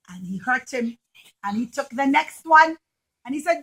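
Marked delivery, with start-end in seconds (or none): none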